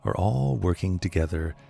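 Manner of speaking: calm, unhurried meditation voice with long pauses; guided meditation. Acted. Teacher guiding listeners to relax, calm meditation voice.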